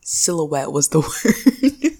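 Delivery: slow, laughing through "Silhouette was the word"; laughing relief. Real, not acted. Host finally remembering the word, laughing slowly. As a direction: giggle as the word finally comes. Oh my god.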